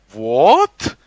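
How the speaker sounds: put-on slow, indignant Russian accent; mock Russian accent, words drawn right out. Retelling a Russian cleaner objecting, slow Russian accent. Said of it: "speaking this Russian accent".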